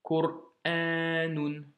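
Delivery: Qur'anun, slow, with a long A. Shown as a demonstration. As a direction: stretch the A in Qur'anun. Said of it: "c'est un A long". (Qur'anun avec un A long, lentement)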